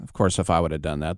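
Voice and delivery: ordinary talk, nothing marked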